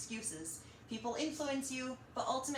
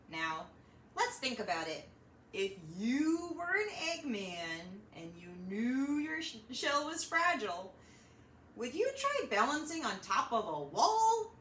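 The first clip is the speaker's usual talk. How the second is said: ordinary delivery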